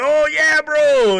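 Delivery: high, loud drawn-out celebration; imitated elated cheer. Brazilian teammate celebrating the kill, hammed-up excited yell. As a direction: whoop it like a thrilled teammate.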